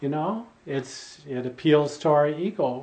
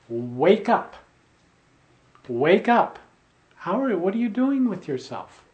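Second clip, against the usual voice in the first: firm wake-up call, louder on each "Wake up"; rousing challenge; 6 semitones higher, 5 dB louder than usual. Acted. Preacher jolting complacent listeners awake, rousing. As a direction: rouse them, then lift the pitch into a challenge.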